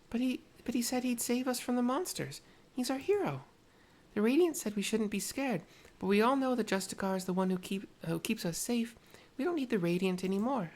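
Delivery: put-on high, small child's voice; little girl voice. Little girl thanking Tepeke, high child voice. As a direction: sound like a grateful little girl.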